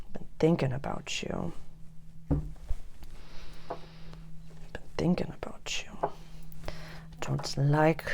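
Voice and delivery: soft, breathy whisper to the listener; intimate whisper. Acted. Reader whispering to the Pisces viewer, soft intimate whisper. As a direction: lean in close and whisper.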